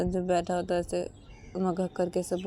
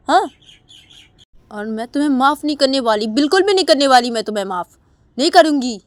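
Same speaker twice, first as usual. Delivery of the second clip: louder, higher, stern scolding; angry scolding; 6 semitones higher, 11 dB louder than usual. Acted. Uma angrily scolds Sanju to stop crying. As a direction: order him to stop the drama. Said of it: "तो तभी उमा बोलती".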